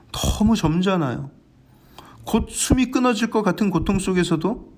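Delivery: ordinary talk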